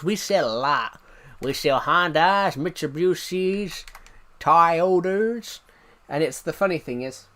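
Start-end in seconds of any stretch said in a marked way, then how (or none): 0.0-5.6 slow, exaggerated American accent; mock American dealership drawl; American car dealer. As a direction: imitate an American salesman, drawing out each word. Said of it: "he talks to an American person".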